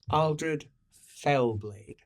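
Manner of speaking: very slow, quiet, weighty; very slow dramatic repeat. Acted. GM repeating the knight's fearsome name, very slow. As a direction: let each syllable hang ominously.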